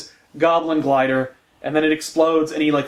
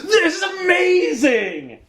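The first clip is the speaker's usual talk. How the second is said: much higher, drawn-out gleeful yell; mock ecstatic shout; 13 semitones higher, slower than usual. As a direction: shriek it like a thrilled editor. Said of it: "Marvel editorial is like".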